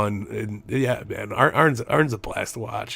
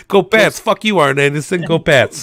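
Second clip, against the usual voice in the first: loud, higher Boston fan chant; Boston accent; 5 semitones higher, 9 dB louder than usual. Retold or imitated. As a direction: shout like a rowdy Boston fan. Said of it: "evil with a Boston accent".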